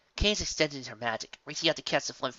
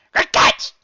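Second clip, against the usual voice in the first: cheered; 16 dB louder than usual. A narrator's reading. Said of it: "Biscuit cheered".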